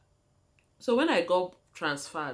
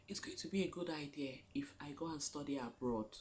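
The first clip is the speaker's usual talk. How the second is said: soft secret whisper; a hushed inner voice; whispered thought; 3 semitones lower, 12 dB quieter than usual. Retold or imitated. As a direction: whisper it like a private idea. Inner thought voiced in her story.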